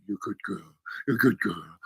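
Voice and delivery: calm the dog gently; soothing dog praise. Retold or imitated.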